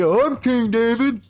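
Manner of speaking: high, slow, hammed-up claim; drawn-out mock boast of being king; mock pretender. A pretender stands up.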